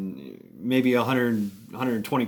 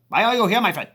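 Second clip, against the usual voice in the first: high, quick Indonesian accent; stronger Indonesian accent; 8 semitones higher, 4 dB louder, faster than usual. Shown as a demonstration. Showing how Tam really asked Steve, thicker Indonesian accent. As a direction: push the Indonesian accent, words run together. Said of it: "Indonesian accent".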